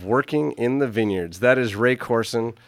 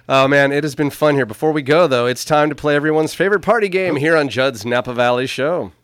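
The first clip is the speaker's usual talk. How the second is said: booming show host intro, louder; announcer voice; 3 semitones higher, 6 dB louder than usual. Pretend. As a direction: hype up the game like a host. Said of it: "How's that for my announcer voice?".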